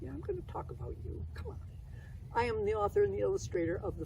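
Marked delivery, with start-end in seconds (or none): 0.0-1.6 puppet aside. Pretend.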